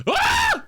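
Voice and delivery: sudden very high, loud shriek; startled scream. Real, not acted. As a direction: yelp at a jump scare. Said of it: "Well, that wasn't very nice".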